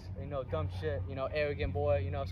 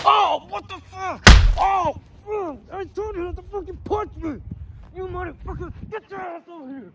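put-on much higher yells fading as the kid runs; furious pained yelling; 12 semitones higher, 11 dB louder than usual. Coach punched, yelling at the fleeing kid. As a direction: scream after him, hurt and furious.

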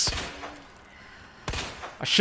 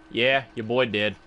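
much lower, softer put-on voice; mock deep voice; 11 semitones lower, 5 dB quieter than usual. Streamer jokes to viewers about the zombie, low mock voice. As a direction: drop low like a joking bro.

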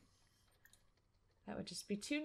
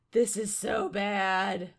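loud, slow, at normal pitch; slow lament without the shriek; sinking dismay; 6 dB louder, slower than usual. Real, not acted. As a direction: draw it out with heavy dread. Repeats it as panic settles.

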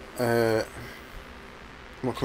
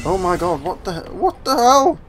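rising to a much higher, louder what the hell; shocked disbelief; 7 semitones higher, 5 dB louder than usual. Real, not acted. Gamer recoiling from the spider creature, high and loud. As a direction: squeal it in disbelief.

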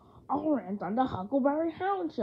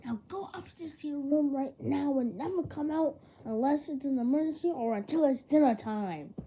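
ordinary talk, nothing marked

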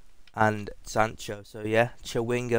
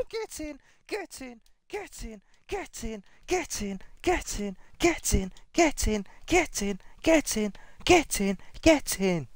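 real excited celebration; 13 semitones higher than usual. Real, not acted. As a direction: chant it over and over, rising in volume. Celebrating a huge pack pull.

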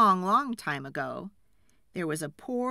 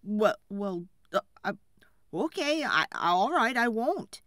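halting start, rising higher and louder; frightened stammer; 3 semitones higher, 5 dB louder than usual. A narrator's reading. Frightened woodcutter answers the fairy, stammering. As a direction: struggle to get the words out. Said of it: "tried hard to speak".

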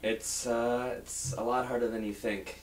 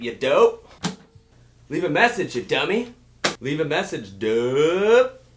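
louder, higher insulting greeting; mock rude shout; 4 semitones higher, 8 dB louder than usual. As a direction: bark the insults at the caller. Insulting voicemail take, shouted.

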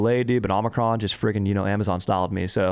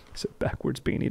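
whisper; 7 dB quieter than usual. Real, not acted. Whispered aside about beanie.

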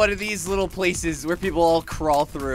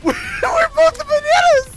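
high, laughing squeal of delight; laughing shout; 4 dB louder, slower than usual. Real, not acted. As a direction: burst out laughing with glee. Laughing with joy.

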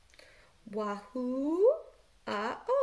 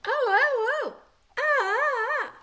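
high, loud squabbling vowels; arguing vowels; 9 semitones higher, 6 dB louder than usual. Shown as a demonstration. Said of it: "they are arguing".

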